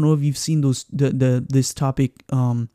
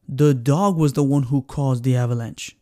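ordinary talk

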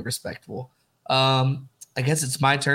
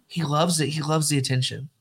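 ordinary delivery

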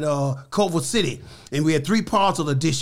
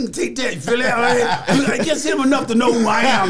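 laughing retelling; 5 semitones higher, 3 dB louder than usual. Retold or imitated. Retelling landing the punches.

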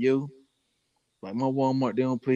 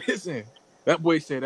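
usual speaking voice

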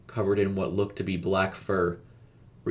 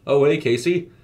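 loud, higher-pitched friendly hello; cheerful greeting; 7 semitones higher, 9 dB louder than usual. A narrator's reading. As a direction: call out a hearty surprised hello. Surprised homeowner greets babysitter on arrival.

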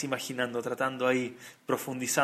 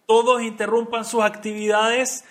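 loud, high camp-wide announcement; calling out; 8 semitones higher, 9 dB louder than usual. Retold or imitated. Madrijim summon the entire camp to the court, calling out. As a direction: project it across the grounds to everyone.

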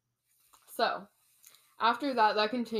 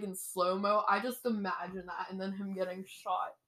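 usual speaking voice

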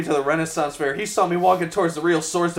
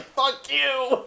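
higher-pitched, drawn-out kiss-off; mock defiance; 8 semitones higher than usual. Voicing tired cartoon aliens quitting, mock defiance. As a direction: toss it out as a mock fed-up retort.

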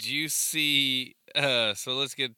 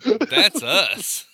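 laughing quote; 7 semitones higher, 6 dB louder than usual. Retold or imitated. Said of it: "He's like".

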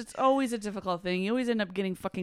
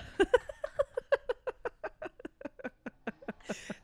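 soft breathy laugh; a quick airy giggle; 5 dB quieter than usual. Real, not acted. Laugh after burp joke.

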